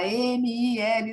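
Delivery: "M I L" soletrado letra por letra, bem devagar; soletrando devagar. Shown as a demonstration. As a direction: soletre "M I L" pausadamente, cada letra separada. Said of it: "eu vou lá e soletro". (soletrando "M I L" letra a letra, devagar)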